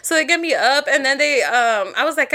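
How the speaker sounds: ordinary delivery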